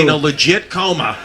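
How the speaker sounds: ordinary talk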